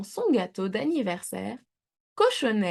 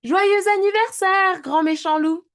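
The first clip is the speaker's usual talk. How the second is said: much higher and louder festive cry; trumpeting; 11 semitones higher, 8 dB louder than usual. A narrator's reading. Both pigs pop out, trumpeting birthday wishes to the wolf. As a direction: blare the greeting like a fanfare. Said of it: "en claironnant".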